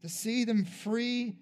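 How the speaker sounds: a pastor's altar-call prayer, swelling; fervent prayer. Acted. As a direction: pray over them with building conviction. Closing prayer over the people.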